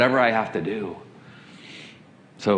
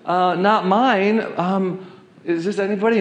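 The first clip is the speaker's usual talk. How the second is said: higher-pitched, hesitant bystander voice; imitated woman bystander; 7 semitones higher than usual. Woman answering about a stray dog, imitated bystander. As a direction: lightly imitate someone caught off guard. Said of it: "one woman looked up and said".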